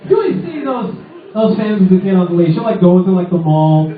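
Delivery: ordinary talk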